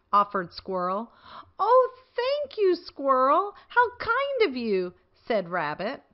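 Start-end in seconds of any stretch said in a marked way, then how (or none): 1.6-4.9 high, grateful exclamation; delighted thanks. A narrator's reading. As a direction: gush your thanks. Rabbit thanking Squirrel.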